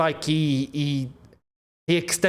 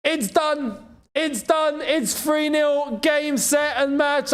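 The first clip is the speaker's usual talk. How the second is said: goal celebration shout; 12 semitones higher than usual. Real, not acted. Fan watching Doué score, ecstatic goal shout. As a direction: erupt as the goal goes in. PSG scores, streamer erupts live.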